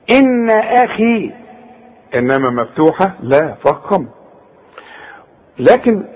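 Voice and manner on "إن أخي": إن أخي drawn out slowly in recitation; recited slowly. Shown as a demonstration. Teacher recites إن أخي to show kasra kha. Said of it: "ممكن تكون خي".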